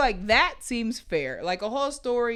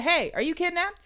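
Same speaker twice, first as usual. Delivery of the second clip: mock worried text; 8 semitones higher than usual. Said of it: "I said".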